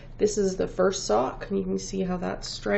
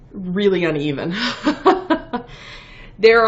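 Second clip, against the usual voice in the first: laughing; 7 dB louder than usual. Real, not acted. Laughs at her uneven yarn.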